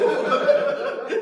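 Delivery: high embarrassed laugh. Retold or imitated.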